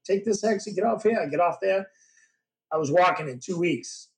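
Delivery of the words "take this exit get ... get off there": higher, upbeat relaying of directions; retold prosthetist on the phone. Prosthetist on the phone telling him to come up, higher and upbeat. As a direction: rattle off directions pitched up like an eager helper. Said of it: "He goes".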